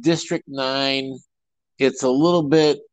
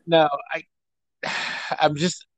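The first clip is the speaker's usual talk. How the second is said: ordinary talk, nothing marked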